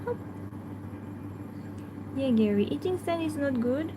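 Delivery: usual speaking voice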